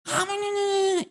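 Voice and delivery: husband snapping at wife, mock shout. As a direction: yell it like an angry spouse. Acted spouse quarrel.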